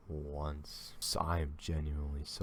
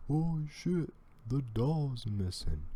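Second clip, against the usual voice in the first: high, slightly louder mock of the video kids; mocking quote; 10 semitones higher, 3 dB louder than usual. Reacting to the video, mocking the kids' high line. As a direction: lightly mimic the kids' fake-scared line. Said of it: "they blatantly says".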